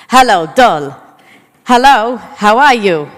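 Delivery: put-on loud Maltese grandma accent; booming old Maltese nonna. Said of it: "take off my other grandma in Maltese".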